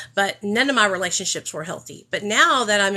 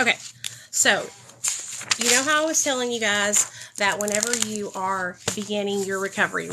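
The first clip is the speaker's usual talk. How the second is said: ordinary talk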